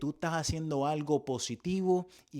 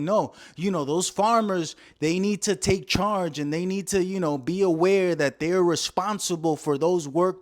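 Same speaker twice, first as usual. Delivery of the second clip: Trump impression; 7 dB louder than usual. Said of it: "Trump now is saying".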